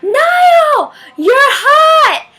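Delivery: high, full-force shout; loud scream. Retold or imitated. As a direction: yell it across the crowd. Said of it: "I screamed so loud".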